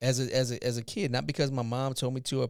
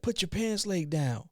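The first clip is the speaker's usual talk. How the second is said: higher, slow, curt order; cop's curt order; 7 semitones higher than usual. Retold or imitated. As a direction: lay down the law to a teen. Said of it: "He's like".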